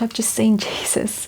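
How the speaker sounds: hushed, breathless whisper of awe; retold whisper telling a friend what she'd just seen; whispered confession. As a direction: whisper it as if still stunned. Stunned confession to concerned friend.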